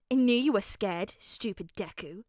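loud jab falling away to a dismissive end; scoffing. A narrator's reading. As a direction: scoff at your scared friend. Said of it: "he scoffed".

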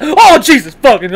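sudden loud startled swearing; startled outburst. Real, not acted. Player cut off mid-boast by danger, startled outburst.

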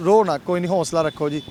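gently calm a weeping elder; soothing comfort. Real, not acted. Vlogger comforting the crying old Sikh man, softly soothing.

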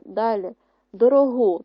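ordinary talk, nothing marked

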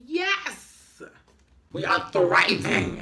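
loud, drawn-out celebration; big, slow victory cry; triumphant yell. Real, not acted. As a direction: shout it out in triumph. Celebrating a success.

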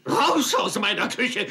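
angry. Acted. Cook throws them out.

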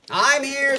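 high, loud mother voice; kangaroo voice. A narrator's reading. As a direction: hop in as a kangaroo.

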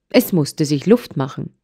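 usual speaking voice

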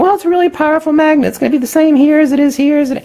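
high, fast doubting voice; skeptic's voice. Retold or imitated. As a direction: play a know-it-all skeptic. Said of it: "someone think".

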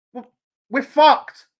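loud, slow, fed-up outburst; angry fan giving up on the match; exasperated. Real, not acted. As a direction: spit it out in disgust.

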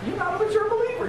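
quick, very high-pitched declaration; emphatic rebuke. Real, not acted. Preacher to those not serving, emphatic rebuke. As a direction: leap up high and rush the point.

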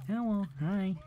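soft put-on Malga greeting; a light character greeting; lightly voiced Malga; Malga roleplay voice. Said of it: "I forgot my Malga roleplay".

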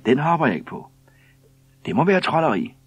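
loud, high-pitched disbelief; incredulous protest. A narrator's reading. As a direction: refuse to believe it, voice shooting up. Baffled postman protests his innocence.

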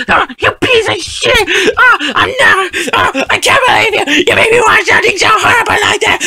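high-pitched shrieking tantrum; hysterical, over-the-top outrage at full pitch; enraged screaming. Acted. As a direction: scream abuse at whoever made you sit through it. Enraged tantrum after watching terrible movie.

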